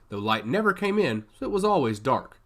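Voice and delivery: ordinary delivery, nothing marked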